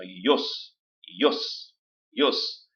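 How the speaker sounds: ios said as one syllable, i and os fused; merged vowels. Shown as a demonstration. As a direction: glide ios into a single beat. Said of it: "Eu tenho que ler assim". (ios numa sílaba só)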